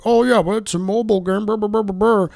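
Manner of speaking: high, dopey dismissive voice; mocking fan voice. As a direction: brush it off like a clueless fan. Mocking defensive fans.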